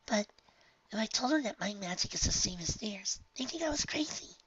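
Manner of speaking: hushed, high and wistful; wishful young filly, quietly; soft high filly voice. Acted. Honeydew voice.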